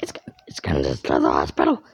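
deep dramatic voice. Acted. Dramatic alarm in story.

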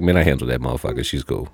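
low, soft, quick aside; dropped-down, nonchalant quote; offhand dismissal. Retold or imitated. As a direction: brush it off casually under your voice. Acted casual report after the call.

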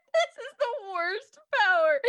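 high giggling disbelief; cracking up while retelling; laughing. Real, not acted. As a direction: laugh the words out in delight. Laughing retelling of silly scene.

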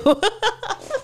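high-pitched giggle; amused high laugh; real laugh. Real, not acted. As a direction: burst out laughing at your own threat. Laughs after mock threat.

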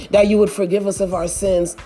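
usual speaking voice

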